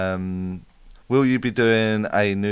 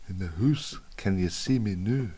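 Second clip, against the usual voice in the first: put-on playful Scottish accent, softer; 7 dB quieter than usual. Streamer joking with live viewers, in a Scottish accent. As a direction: put on Scottish vowels, "house" as "hoose". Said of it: "I wanna say that with a Scottish accent".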